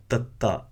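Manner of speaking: tatta with a soft dental T, tongue on teeth. Shown as a demonstration. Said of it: "It's a soft T sound".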